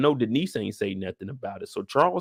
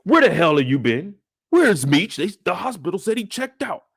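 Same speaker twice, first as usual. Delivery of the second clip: angry father; 6 semitones higher, 3 dB louder than usual. Retold or imitated. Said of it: "He said".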